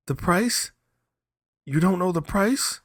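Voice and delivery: high, loud startled question; startled young man. Acted. Young man stunned by the request, high and loud.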